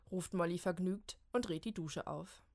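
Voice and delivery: ordinary delivery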